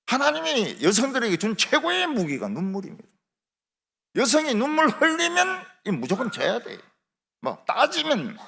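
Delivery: high-pitched, insistent pulpit delivery; emphatic preaching. Real, not acted. Preacher telling men to yield to weeping wives, emphatic preaching. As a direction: drive each point home high above normal speech.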